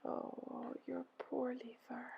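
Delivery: hushed, slow sigh of empathy; soft echoed sigh. A narrator's reading. Helpers echo Ereshkigal's pain, whispery sympathetic sigh. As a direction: sigh it back in a near whisper. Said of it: "They sighed".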